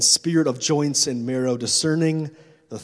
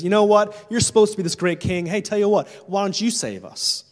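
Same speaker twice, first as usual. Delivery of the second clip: loud, sneering jeer at Jesus; mock him like a bitter dying criminal; 5 semitones higher, 4 dB louder than usual. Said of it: "He's bitter and angry".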